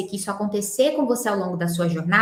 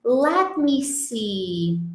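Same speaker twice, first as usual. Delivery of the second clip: "Let me see" drawn out slowly, lemme linked; much slower than usual. Shown as a demonstration. Teacher shows how natives stall, slow linked lemme see. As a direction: let it stretch like thinking aloud. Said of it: "é muito comum deles falarem".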